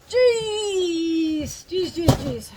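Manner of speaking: high, loud strained groans of effort; real effort from lifting the heavy box; straining under a heavy box. Real, not acted. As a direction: puff it out while hauling something heavy. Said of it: "I'm out of breath 'cause that box is heavy".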